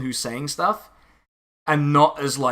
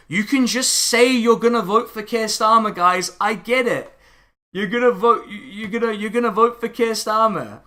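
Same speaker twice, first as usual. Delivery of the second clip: higher-pitched, exasperated mock reassurance; pitched up, insistently letting them off; exasperated concession; 6 semitones higher than usual. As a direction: plead with absent critics to just admit it. Exasperated address to absent critics.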